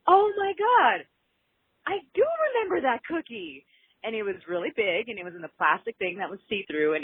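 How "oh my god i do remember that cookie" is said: high, excited gasp of recognition; light up as the memory hits; remembering excitedly. Retold or imitated. Caller retelling her cookie memory, high and excited. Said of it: "I was just like".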